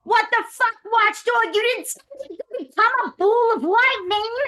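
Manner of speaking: high-pitched acted outburst at a teammate; hammed-up, high scolding; indignant yell. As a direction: yell at them in mock outrage. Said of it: "like I yelled at Joe".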